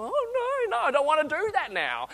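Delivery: high, whiny refusal; mock reluctance. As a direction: whine like someone dodging a challenge. Reluctant listener mocked.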